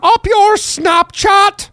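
high, drawn-out jeer; mock it high and slow; high slow taunting voice.

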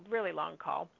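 ordinary talk, nothing marked

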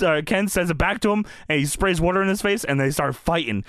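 ordinary talk, nothing marked

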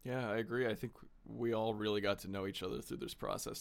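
ordinary delivery

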